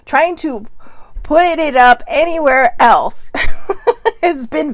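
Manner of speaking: can't keep a straight face; laughing while talking. Real, not acted. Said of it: "Sorry, I'm making myself laugh".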